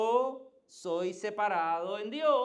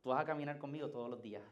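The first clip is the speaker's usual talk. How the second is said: tender voice of God; 7 semitones lower, 7 dB quieter, faster than usual. Retold or imitated. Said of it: "Dios te separó y te dijo".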